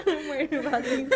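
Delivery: cracking up while talking; laughing hard. Real, not acted. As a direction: laugh helplessly through the words. Listener cracking up at the guest.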